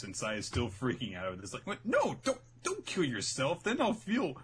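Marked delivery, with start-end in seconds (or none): 1.7-4.4 panicked plea. Retold or imitated. Sai begging her stop.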